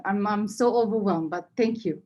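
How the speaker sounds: ordinary delivery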